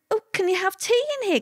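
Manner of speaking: high, quick, amazed quote of herself; high, quick mock amazement at being offered tea; surprised. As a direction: pitch it way up in wide-eyed surprise. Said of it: "I said".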